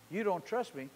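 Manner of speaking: ordinary talk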